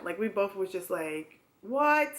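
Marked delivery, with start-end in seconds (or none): 1.6-2.1 high, loud shocked exclamation; shocked what. Retold or imitated. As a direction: blurt it in disbelief. Shocked couple reaction.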